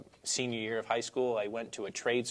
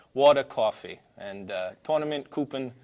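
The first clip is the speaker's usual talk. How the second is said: New York accent; 4 semitones higher, 7 dB louder than usual. Shown as a demonstration. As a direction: lean into a Brooklyn New York accent on each word. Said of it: "Can you say something in your New York accent?".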